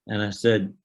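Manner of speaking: ordinary delivery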